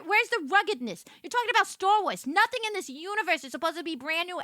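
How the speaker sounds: ordinary talk, nothing marked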